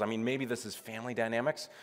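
normal talking voice

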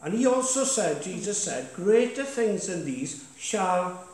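punchy pulpit declaration; preaching. Acted. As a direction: exhort the church, pushing key words. Pastor exhorts congregation from the front.

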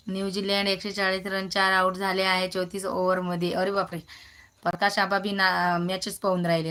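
ordinary delivery

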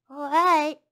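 high, loud piglet voice; reluctant piglet. A narrator's reading. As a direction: give in as the piglet. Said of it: "said the piglet".

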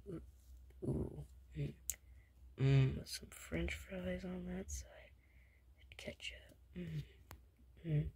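slow, whispered food fantasy; hushed craving. Acted. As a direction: murmur every dish like a dream. Savouring the food in a hush.

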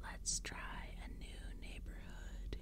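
slow, very soft creature rasp; crackling whisper. A narrator's reading. Pumpkinhead refusing to slow down, crackling in a whisper. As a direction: whisper it dry and drawn out. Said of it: "he crackled".

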